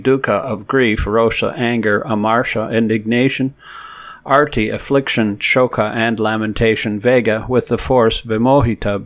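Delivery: ordinary talk, nothing marked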